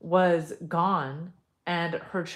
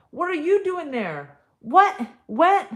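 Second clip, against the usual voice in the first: high, loud, stunned questions; shocked disbelief; 11 semitones higher, 10 dB louder than usual. Acted. Grandmother stunned to hear Roberto's spirit. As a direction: cry out in disbelief.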